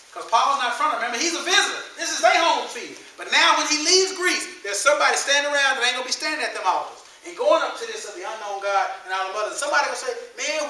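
loud, high sermon drive; full force preaching. Acted. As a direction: press it on the crowd.

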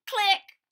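high loud click noise; camera click sound. Pretend. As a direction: snap a click.

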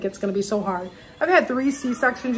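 ordinary talk, nothing marked

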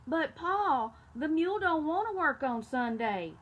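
put-on boy protesting to his father. A narrator's reading. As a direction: whine it up at Pa. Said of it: "the boy protested".

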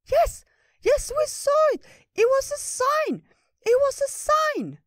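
very high, loud, slow, eager little creature; excited squirrel. Acted. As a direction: cry out eagerly in a tiny high voice. Ratatosk eagerly agreeing.

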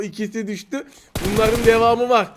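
ordinary talk

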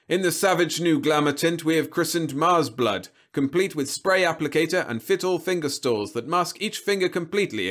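high, loud TV-advert patter; polished pitchman robot in full flow; smooth robot salesman. A narrator's reading. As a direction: sell it like a recorded TV star.

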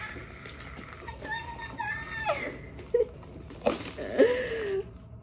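high, long squealing laugh of delight. Real, not acted. Delighted by the plant.

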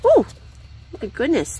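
ordinary talk, nothing marked

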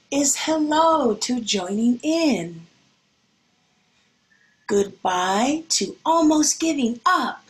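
gentle picture-book reading voice; storybook reading. Acted. Teacher reading a picture book to her class, gentle.

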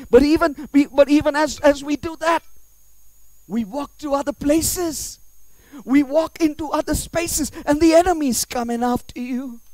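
high, loud sermon voice; full-force preaching. Acted. Warning that enemies come after you, loud impassioned preaching.

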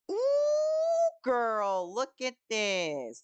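very high, loud, drawn-out sassy squeal; sassy diva. Pretend. Cook gawking at the new orders, loud sassy squeal. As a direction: gush like a dramatic diva, stretching it out.

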